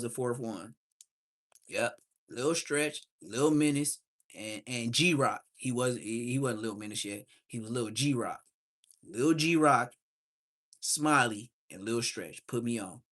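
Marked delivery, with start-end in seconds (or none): none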